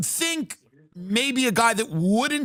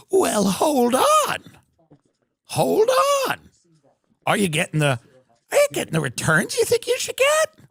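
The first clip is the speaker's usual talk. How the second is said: put-on creepy childlike Epstein; 12 semitones higher than usual. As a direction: squeak high to keep him on the line. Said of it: "childlike voice".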